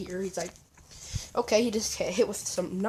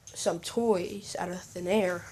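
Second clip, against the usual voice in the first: ordinary delivery, nothing marked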